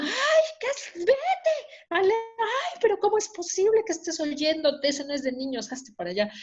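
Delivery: high shrieky mock-scolding mom; mother's scolding. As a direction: imitate mom shooing a nosy kid. Mother scolding eavesdropping child, acted.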